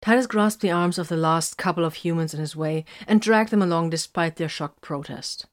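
usual speaking voice

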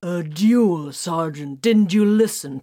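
loud, sharp, rising angry retort. A narrator's reading. Titus snaps back at Grimfang, loud and angry. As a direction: snap back in rising anger. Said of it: "The anger flared up so hotly".

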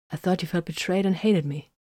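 ordinary delivery, nothing marked

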